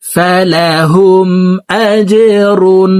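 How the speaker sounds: slow melodic verse reading; Quran recitation. Shown as a demonstration. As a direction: chant with lengthened vowels. (সুরেলা ধীর তিলাওয়াত)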